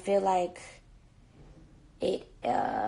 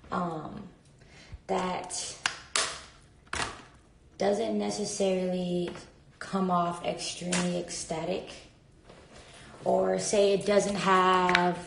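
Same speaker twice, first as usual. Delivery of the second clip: normal talking voice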